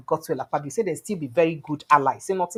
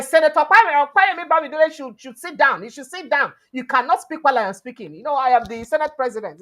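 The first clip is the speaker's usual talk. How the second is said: raised, sharp voice of an angry Senate president; mock angry outburst, pitched up and louder; angry retort; 7 semitones higher, 4 dB louder than usual. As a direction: snap back at him, loud and high. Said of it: "fire back sharply".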